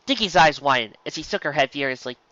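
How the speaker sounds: usual speaking voice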